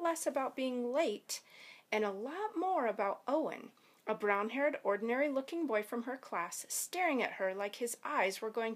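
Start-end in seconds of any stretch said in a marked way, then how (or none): none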